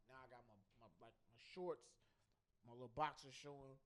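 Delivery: ordinary talk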